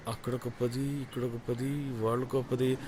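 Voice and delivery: very soft murmured tally; calculating to himself. Acted. Buyer adding up the order, murmuring to himself. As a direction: think aloud under the breath.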